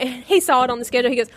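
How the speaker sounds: ordinary talk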